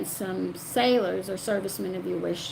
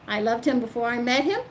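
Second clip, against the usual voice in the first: usual speaking voice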